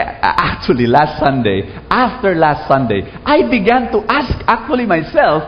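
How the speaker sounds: usual speaking voice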